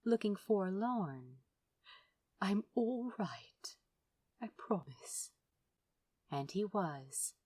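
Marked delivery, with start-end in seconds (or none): 2.4-5.3 breathed. Acted. Aziraphale breathing comfort to Crowley, hushed whisper. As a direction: breathe it out gently to reassure. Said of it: "he breathed".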